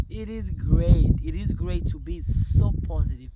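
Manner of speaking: loud, exultant declaration; jubilant, full-volume delight; elated. Real, not acted. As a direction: proclaim it joyfully after a laugh. Exuberant affirmations after laughing.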